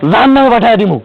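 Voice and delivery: loud, high outburst; enraged threat. Acted. Husband threatens the wife who refuses to return money, enraged shout.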